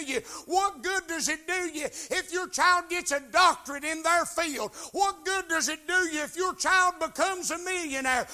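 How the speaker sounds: loud, driving sermon delivery, hammering each point; full-force preaching. Acted. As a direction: preach it hard to the congregation, rising and pounding.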